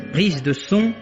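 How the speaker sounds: ordinary delivery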